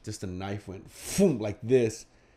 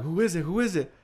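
anxious calling out; 6 semitones higher than usual. Retold or imitated. Calling through door at intruder.

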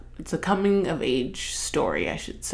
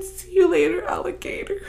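character's farewell line; 13 semitones higher than usual. Retold or imitated. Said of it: "When he said".

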